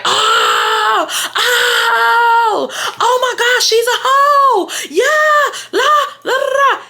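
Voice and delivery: very high, loud shrieking laughter with mock cries; mocking shrieks. Classmates jeering at her, shrieking with mocking laughter. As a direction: cackle and shriek like a jeering crowd. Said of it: "Now everybody is like".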